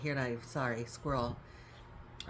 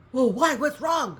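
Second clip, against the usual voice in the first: higher and much louder, startled; alarmed reply; 8 semitones higher, 11 dB louder than usual. Retold or imitated. Relative startled by the reminder, loud alarmed question. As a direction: blurt it out as if something's wrong.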